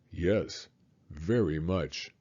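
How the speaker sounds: tender dad reassuring his son; loving father. Acted. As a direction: answer like a father moved by the question. Father voice reading dad's lines.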